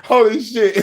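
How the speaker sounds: high retold astonishment with laughter; shocked. Viewer reliving the ex-pastor's cursing, shocked and laughing. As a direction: blurt it high, cracking up in mock shock. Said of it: "I was shocked".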